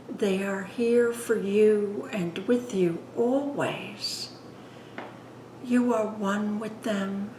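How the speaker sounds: unhurried, hushed guidance, phrase by phrase; guided meditation. Acted. Leads guided meditation after plain introduction.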